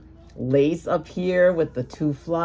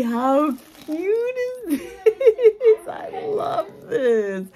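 high, drawn-out adoring squeal; gushing squeal; 14 semitones higher, much slower than usual. Real, not acted. Crafter admiring a gift, gushing squeal. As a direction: stretch it out in a high, melting coo.

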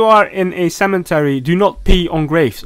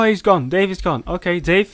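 ordinary delivery

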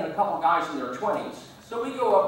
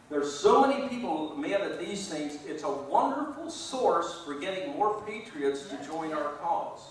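ordinary talk

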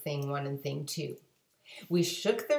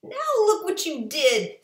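much higher, louder scolding fish; 11 semitones higher, 7 dB louder than usual. A narrator's reading. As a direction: scold the cat in a high squeak. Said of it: "said the fish to the cat".

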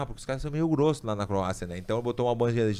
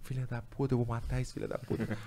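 half-hearted retold cursing, hushed; muttered cursing; 9 dB quieter than usual. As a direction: mutter the swearing as if crying to yourself. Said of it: "chorando, chorando, brincava assim".

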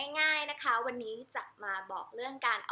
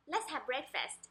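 quicker, softer "Let's have breakfast", words run together; natural rhythm; 5 dB quieter, much slower than usual. Shown as a demonstration. Teacher shows the everyday rhythm, quick and linked. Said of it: "จริงๆ อย่างนี้ก็ได้นะคะ เราเอาจังหวะมา".

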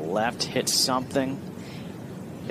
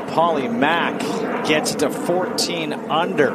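raised, slow, building birdie call; golf commentator; 6 semitones higher, 4 dB louder, slower than usual. Acted. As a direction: lift it into a highlight call. Calling a birdie.

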